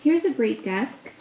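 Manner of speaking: ordinary talk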